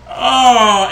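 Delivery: long, loud, high wailed "oh"; imitated crying wail. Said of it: "I just start cr-, like, crying more".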